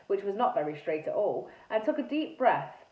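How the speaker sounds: ordinary delivery, nothing marked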